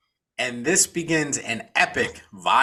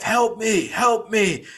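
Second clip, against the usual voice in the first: begging; 8 semitones higher, slower than usual. A narrator's reading. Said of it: "begging for his life".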